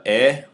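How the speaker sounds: "eh" as the open vowel in pet; short E sound. Shown as a demonstration. Teacher isolating the vowel put on this, a short E sound. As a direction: sound out a lone "eh". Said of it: "an E on this, as in pet".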